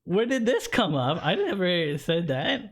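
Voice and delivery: high, incredulous denial; baffled protest. Retold or imitated. Cyclist retelling his denial to the cop, pitched high. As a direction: plead your innocence, floored. Denying the cop's accusation.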